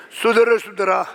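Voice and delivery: ordinary delivery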